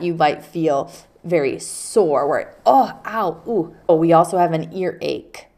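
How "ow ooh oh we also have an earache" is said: ordinary talk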